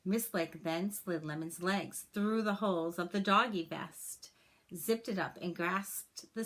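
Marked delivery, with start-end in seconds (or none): none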